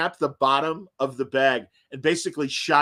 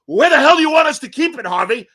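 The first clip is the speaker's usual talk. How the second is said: much higher, loud, fast angry yell; 11 semitones higher, 11 dB louder than usual. Retold or imitated. Host shouting back at the station manager, angry yell. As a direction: fire it back at the boss. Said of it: "Jiggins goes".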